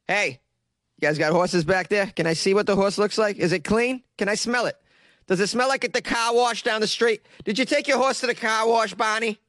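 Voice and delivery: comic Boston cop poking around horse barns; nosy investigator. Acted. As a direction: pester them with eager questions in Boston accent. Acts out police questioning barn owners.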